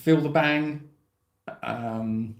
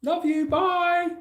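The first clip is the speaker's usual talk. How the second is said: high playful goodbye; 14 semitones higher, much slower than usual. Acted.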